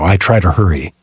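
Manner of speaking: ordinary delivery, nothing marked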